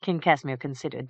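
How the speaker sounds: ordinary delivery, nothing marked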